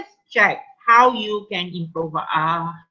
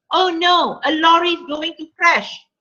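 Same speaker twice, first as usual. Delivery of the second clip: higher, a touch of horror in the voice; frightened cry; 6 semitones higher than usual. Shown as a demonstration. Teacher reading the model sentence to class, with mock fright. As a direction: cry out a warning of the crash. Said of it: "This has more impact".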